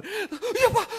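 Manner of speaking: put-on high, laughing child's answer; high mock child voice. Child answering father, high and giggly. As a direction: squeak it out like a nervous kid.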